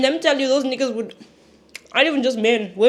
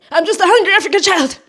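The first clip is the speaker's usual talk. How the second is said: higher, louder joking voice; mock hungry kid; 7 semitones higher, 10 dB louder than usual. Excusing her eating to viewers, a loud high comic voice. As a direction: ham up the starving kid bit.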